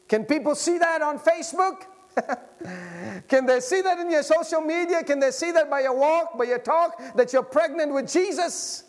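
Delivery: loud, high preacher's cry; full-force preaching. Acted. Pastor urging the congregation to be full of Jesus, preaching loud. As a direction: preach it out to the whole church.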